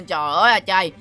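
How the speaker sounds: low, sighing dismay; deflated. Acted. Villain's roaches have vanished, low deflated sigh. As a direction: sigh and let the voice sink. Villain's plan has been undone.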